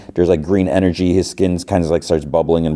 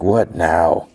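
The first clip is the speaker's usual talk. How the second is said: ordinary delivery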